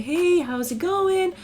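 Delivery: high, slow sing-out greeting; mock-cheery neighborly hello; friendly neighbor. Canadian neighbor greeting you, over-friendly. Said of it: "being like".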